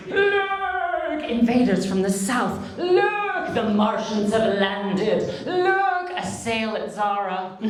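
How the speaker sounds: high, loud, slow cries of look, like a town crier; wizard's warning. Pretend. Comedian playing a wizard who warns a village of peril. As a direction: proclaim the peril to the whole village.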